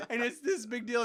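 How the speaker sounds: normal talking voice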